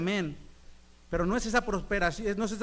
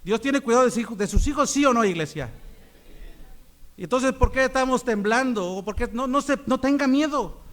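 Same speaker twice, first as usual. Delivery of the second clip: loud, high preaching climax; full-force preaching; 4 semitones higher, 6 dB louder than usual. Acted. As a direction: shout the point to the church.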